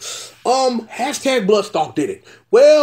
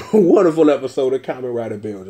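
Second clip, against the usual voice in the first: ordinary talk, nothing marked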